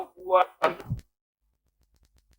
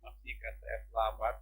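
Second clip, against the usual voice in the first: much softer, lower, almost inward; soft inner voice, much softer; said in the heart; 6 semitones lower, 12 dB quieter than usual. Shown as a demonstration. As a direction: say it quietly to yourself. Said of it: "ngomong di hatimu".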